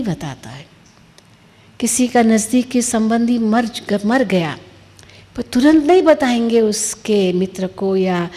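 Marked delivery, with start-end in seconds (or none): none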